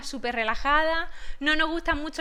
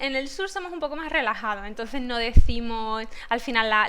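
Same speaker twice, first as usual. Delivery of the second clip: ordinary delivery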